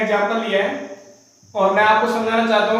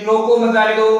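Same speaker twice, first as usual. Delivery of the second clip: रोको मत जाने दो rattled off in one go; quick, without pause. Shown as a demonstration. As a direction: read रोको मत जाने दो straight through, no comma. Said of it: "बहुत गति से आप पढ़ रहे हो". (रोको मत जाने दो बिना रुके, तेज़ गति से)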